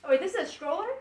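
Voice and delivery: ordinary talk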